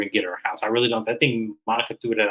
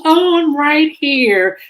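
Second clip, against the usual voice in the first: very high, loud, slow put-on voice; mocking Angie; 7 dB louder, much slower than usual. Mocking Angie, oblivious at the dinner table. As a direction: sing it out cluelessly sweet. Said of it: "She goes".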